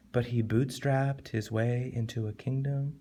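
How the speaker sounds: soft, even, unemphatic reading; wrong tone, understated. Shown as a demonstration. Teacher showing how the poem shouldn't sound, soft and understated. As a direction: play down the line, softly. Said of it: "It doesn't sound like you should say it like".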